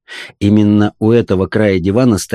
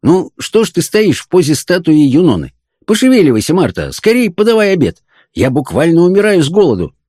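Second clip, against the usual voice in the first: higher-pitched, bossy husband; impatient demand; 7 semitones higher than usual. A narrator's reading. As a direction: order the wife about, hungry and impatient.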